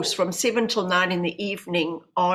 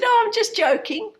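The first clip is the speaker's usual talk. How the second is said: much higher, louder, forced breezy; hasty cover-up; 14 semitones higher, 5 dB louder than usual. Retold or imitated. Covering up to her husband, high and forced breezy. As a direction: laugh it off quickly.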